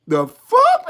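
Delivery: high-pitched astonished question; squeaked mock bewilderment; amazed disbelief. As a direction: blurt it up high. Acted amazement at the cartoons.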